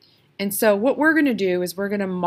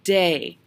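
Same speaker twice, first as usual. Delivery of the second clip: "day" punched and drawn out; punched stress. Shown as a demonstration. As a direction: punch the syllable "day", holding it long. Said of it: "I stressed that. I punched that".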